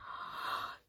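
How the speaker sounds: sharp storybook gasp of wonder; amazed intake of breath; theatrical gasp. A narrator's reading. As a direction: gasp before the big reveal. Narrator gasps before the reveal.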